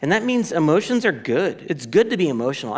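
ordinary talk

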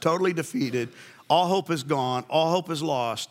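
ordinary talk, nothing marked

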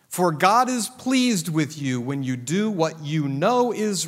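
loud pulpit reading of First Peter; forceful scripture reading. Acted. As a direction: proclaim each verse to the congregation. Reads scripture at full force.

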